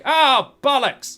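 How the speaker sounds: high, loud, slow cry of dismay; exasperated prince. Acted. Prince watching his champion lose, exasperated. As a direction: groan it out loudly in defeat.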